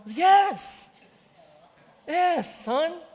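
high, loud insistent yes; emphatic yes. Retold or imitated. Father insisting to his doubting son, high and loud. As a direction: reassure him with full conviction.

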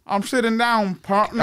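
cowboy-style Texan reply; mock Texan; Texan accent. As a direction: answer a partner in a Texan drawl. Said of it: "two Texans".

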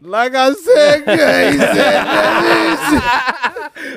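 slow, laughing, high and loud protest; laughing drawl. Real, not acted. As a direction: stretch it out through laughter.

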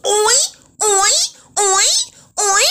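high, loud repeated "Oi" moans; mock moans. Mocking the porn actress's moans, high and loud. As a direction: ham up the moaning rhythmically. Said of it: "It was".

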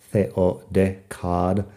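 ordinary talk

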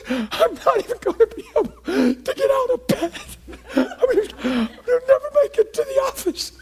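overwhelmed cry breaking into laughter; high cracking voice, half laugh half sob; choking with emotion. Retold or imitated. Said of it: "I was choking to even say words".

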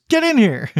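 loud summons; calling over. Retold or imitated. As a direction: order him in from afar. Summoning Wilmot.

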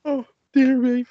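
teary, laugh-choked sigh; laughing till crying. Real, not acted. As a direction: wheeze it out through laughter tears. Recovering from a fit of laughter.

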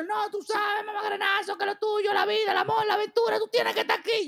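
high sing-song mocking voice; mocking boss. Boss Moisés telling a sick worker to stay, high mocking sing-song. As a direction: chant it like a preachy boss, way up high. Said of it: "Me dice".

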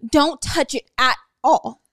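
slow, emphatic imitated warning; dead serious imitation. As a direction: mimic him, dead serious, drawing it out. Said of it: "his voice is like".

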